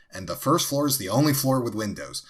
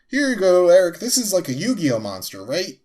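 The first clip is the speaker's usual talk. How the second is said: higher, louder, gushing handover; mock excited gift giving; 8 semitones higher, 5 dB louder than usual. Imitating Devin handing Eric a card, excitedly. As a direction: hand it over like a thrilled kid.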